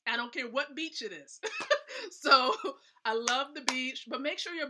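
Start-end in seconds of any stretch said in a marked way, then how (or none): 1.5-2.0 a high burst of laughter. Real, not acted. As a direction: laugh out, giddy. Laughs at her beach love.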